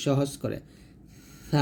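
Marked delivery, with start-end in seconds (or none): 1.5-1.6 সা with the tongue tip touching the upper front teeth; Arabic tha sound. Shown as a demonstration. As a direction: say সা lisped and thin, tongue at the teeth. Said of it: "দুই দাঁতের আগার সঙ্গে লাগিয়ে এই সাটা উচ্চারণ করতে হবে".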